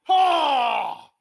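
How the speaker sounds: loud high triumphant Ha; triumphant shout. Pretend. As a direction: bark out a victory cry. Triumphant yell.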